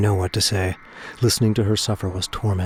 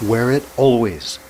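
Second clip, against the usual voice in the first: calling out; 4 semitones higher, 4 dB louder than usual. Acted. Calling over fading static.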